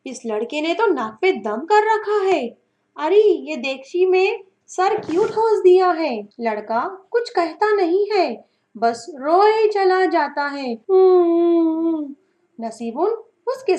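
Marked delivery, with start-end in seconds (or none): 0.1-6.3 sharp scolding, words pushed through gritted teeth; angry through gritted teeth. Acted. Sister scolding the boy stuck in the pot, angry through gritted teeth. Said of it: "नसीबुन दांत पीसती हुई". 10.7-12.2 muffled crying. Acted. Boy crying inside pot.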